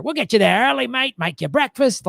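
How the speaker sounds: put-on Australian helpfulness; awful Australian accent. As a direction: imitate Australians eager to see guests off. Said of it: "My awful Australian accent".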